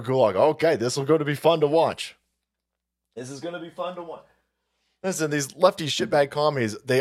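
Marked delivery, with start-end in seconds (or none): 3.1-4.2 much softer, slightly quicker repeat; hushed aside. Real, not acted. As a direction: say it under your breath, quickly.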